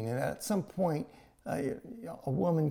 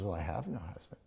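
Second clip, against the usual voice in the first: whispered admission; 5 semitones lower, 6 dB quieter than usual. Retold or imitated. Woman admits to Jesus.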